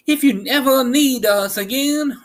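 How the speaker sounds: high, loud squeaky little queen voice; a tiny regal mouse speaking up. Acted. Queen of Mice bids Dorothy farewell, high squeaky.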